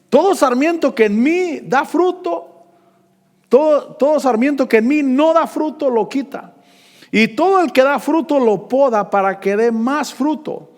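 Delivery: loud, high proclaiming preacher; preaching at full force. Acted. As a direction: ring out the verse from the pulpit. (predicación a todo pulmón)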